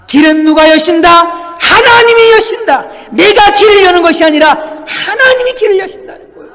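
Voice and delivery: loud, high proclamation; full-force preaching. Real, not acted. Preacher declaring that God opens the way, full-force proclamation. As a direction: thunder it out to the congregation.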